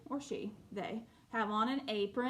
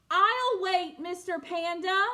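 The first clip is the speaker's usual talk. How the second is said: a much louder, higher penguin call; 8 semitones higher, 8 dB louder than usual. Acted. Little penguin loudly telling Mister Panda he'll wait. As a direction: call it out loud and proud. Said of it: "He said it loud that time".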